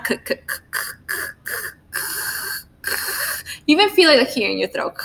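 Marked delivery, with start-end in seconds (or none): 0.0-2.9 voiceless clicked "K, k, k" with no vowel; just the stop "k", no voice behind it; bare K sound. Shown as a demonstration. Teacher repeating the bare "k" sound for students. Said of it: "Remember the sound?".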